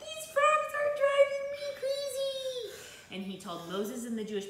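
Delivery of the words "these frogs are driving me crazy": very high, drawn-out mock screech; crazed King Pharaoh. Showing children the crazed king, high stretched squeal. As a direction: squeal it slowly, hammed-up exasperation. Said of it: "Can you show me you're crazy".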